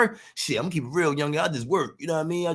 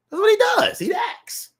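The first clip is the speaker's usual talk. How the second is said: exasperated shout; slower than usual. Real, not acted.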